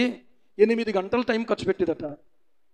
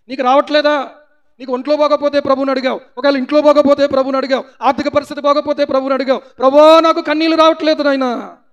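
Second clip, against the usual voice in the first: loud, high pleading sermon; preacher pressing the congregation; fiery preaching; 6 semitones higher, 8 dB louder than usual. Acted. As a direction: challenge the listeners loudly. Preaching at full force.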